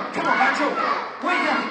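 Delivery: loud, high wake-up call; urging shout, pitched high. Pretend. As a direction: holler to rouse a sleeping puppy. Calling sleeping puppet to wake.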